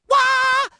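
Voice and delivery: high, whooping "wah"; excited rock wail. Acted. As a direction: let out a rocker's high wail. Rocking out in excitement.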